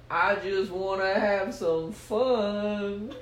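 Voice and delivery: high, slow, stretched-out plea; hammed-up high whine; playful plea. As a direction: draw it out like someone begging to go play. Stoogie pleads with the demon.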